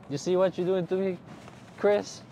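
mock complaint.

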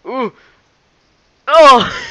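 sharp, high, very loud "oh"; high loud outburst; startled yell. Real, not acted. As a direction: yelp at the sudden hit. Reacts to a moment in game.

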